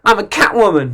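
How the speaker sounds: mock sultry cat lady; Catwoman voice. Imagined Halle Berry rehearsing at home, lightly voiced Catwoman. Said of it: "Halle Berry about to play Catwoman".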